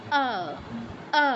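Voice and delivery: আ said with lips spread wide; Sanskrit-style আ; Sanskrit a vowel. Shown as a demonstration. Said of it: "সংস্কৃতে কি হচ্ছে".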